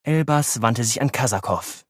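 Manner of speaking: plain reading voice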